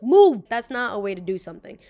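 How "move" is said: sudden loud bark, pitched up; rude shout. Retold or imitated. Imagined shout at girls blocking the doorway, loud and rude. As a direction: yell it at the people blocking the door. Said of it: "I'm not gonna look at you and be like".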